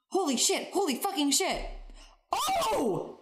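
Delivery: reenacts the scene, pitch jumping high; high mock scream. Podcast host acting out the movie scene, high mock shriek. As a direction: squeal it like a caught-out actor. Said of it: "the exchange goes like this".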